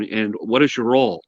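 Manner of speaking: normal talking voice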